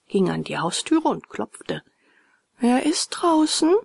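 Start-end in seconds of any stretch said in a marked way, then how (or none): 2.6-3.7 thin, high call; high-pitched small-creature voice; fine little voice. A narrator's reading. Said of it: "rief eine feine Stimme".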